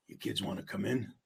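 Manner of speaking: low, hushed, mock-creepy voice; creepy invitation. Homeowner at the door to trick-or-treating kids, creepy invitation. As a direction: drop it down soft and sinister, like luring someone in.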